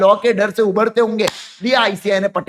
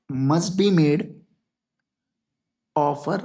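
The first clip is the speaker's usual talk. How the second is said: stretched out phrase by phrase, lower; slow dictation; 5 semitones lower, much slower than usual. Shown as a demonstration. Teacher dictating the rule to students, slow and lower. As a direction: spell it out slowly for note-taking.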